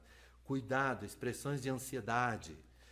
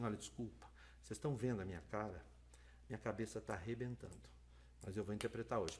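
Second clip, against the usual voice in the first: quiet apology; 5 semitones lower, 8 dB quieter, faster than usual. Retold or imitated. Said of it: "Aí eu chegava pro surdo e dizia assim".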